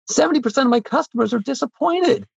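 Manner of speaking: high-pitched retort, driving the point home; emphatic retort. Retold or imitated. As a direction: fire back the hard truth. His reply to case-break requests.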